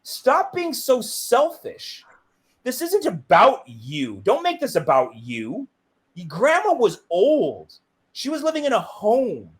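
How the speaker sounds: exasperated scolding. Retold or imitated. Child scolding crying mom for selfishness, exasperated. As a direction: lecture firmly, drawing out the key words.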